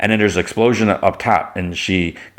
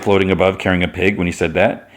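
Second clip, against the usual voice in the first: ordinary talk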